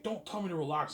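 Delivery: boss snapping back. Retold or imitated.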